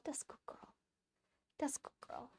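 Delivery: breathy whisper of praise to the dog. Real, not acted. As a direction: whisper it to soothe the puppy.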